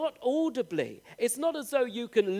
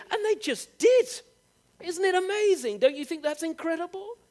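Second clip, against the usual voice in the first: amazed; 7 semitones higher than usual. Real, not acted. Preacher marvelling that the disciples just followed, amazed.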